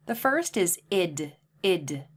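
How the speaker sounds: the ending id said slowly and clearly; id, a short extra syllable; id sound. Shown as a demonstration. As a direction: isolate the id sound. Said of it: "listen carefully".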